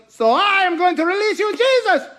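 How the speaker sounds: high, loud showman announcement; grand announcement. Retold or imitated. Pilate announcing Jesus's release to the crowd, showman-like. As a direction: proclaim it like a prize reveal.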